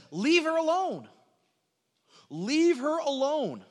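high, loud, drawn-out command; firm rebuke. Acted. Jesus to the woman's critics, firm rebuke. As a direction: ring out each word in rebuke.

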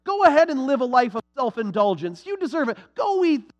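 high, sugary mock praise; mocking flattery. Preacher mocking the world's flattery, high sugary voice. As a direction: coo it like a fawning salesman. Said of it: "that's what they want to tell you".

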